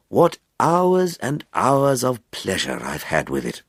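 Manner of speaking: slow, savouring, a touch rapturous. A narrator's reading. Amateur astronomer tells colleagues of his telescope, mildly rapturous. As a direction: linger lovingly over the pleasure. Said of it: "flinched slightly at the rapturous tones of the man".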